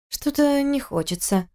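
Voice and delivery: put-on high, slow, soft tipsy girl; sleepy drunk refusal.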